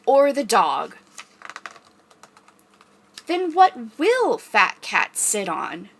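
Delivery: slow, drawn-out read-aloud question; reading to children. Pretend. Librarian reading picture book to kids, slow suspenseful question.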